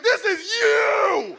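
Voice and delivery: very loud, high and slow screamed taunt; crazed shout. Retold or imitated. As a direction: scream it at the kids. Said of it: "I'm like".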